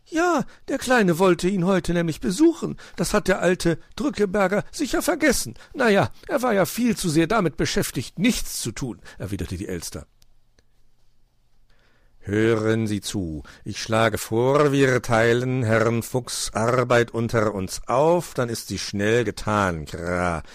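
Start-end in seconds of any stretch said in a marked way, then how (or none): 0.0-9.0 high, agitated bird gossip; lively, scolding magpie; indignant magpie. A narrator's reading. 12.2-20.4 slow, emphatic raven with rolled r; drawn-out, commanding bird voice; pompous raven. A narrator's reading.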